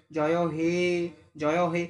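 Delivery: "হে" stretched each time; drawn-out ending. Shown as a demonstration. Teacher showing we lengthen it, "হে" drawn out. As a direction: repeat "জয় হে", holding "হে". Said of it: "হেটাকে আমরা টান দিই".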